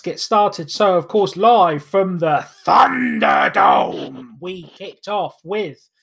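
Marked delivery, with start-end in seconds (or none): 1.3-4.4 slow, shouted ring announcement; arena announcer. Acted. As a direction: call it out to the arena. Announces show location, arena style.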